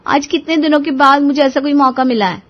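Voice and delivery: delighted lift in the voice; happy wife. Acted. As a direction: relish a long-awaited treat. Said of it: "श्रुति बहुत खुश थी".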